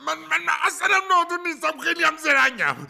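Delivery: high, loud indignant protest; defensive shout. Acted. Husband defends himself heatedly.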